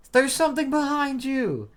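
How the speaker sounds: very high, loud shrieked warning; panicked scream. Acted. Character warns Frankie of a creature behind, screaming. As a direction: shriek it in alarm, voice way up.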